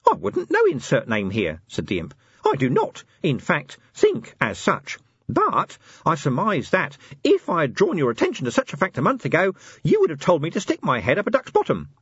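high, chirpy little assistant; squeaky helpful gadget voice; tiny imp voice. Acted. Imp answers.

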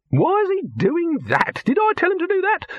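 very high-pitched indignant questions; outraged. A narrator's reading. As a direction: squawk it in disbelief. Dibbler outraged in scene.

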